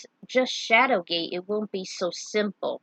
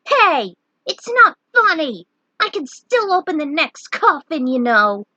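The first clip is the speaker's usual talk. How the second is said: put-on high loud protesting kid; indignant talking back; 8 semitones higher, 9 dB louder than usual. Scared knight talking back to his teaser, indignant. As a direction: snap back, offended. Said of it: "talked back".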